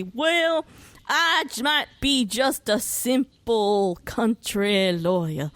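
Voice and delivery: slow, drawn-out Southern US drawl; Southern lawyer. Acted. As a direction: ham up a folksy Southern country lawyer. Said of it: "the Southern lawyer".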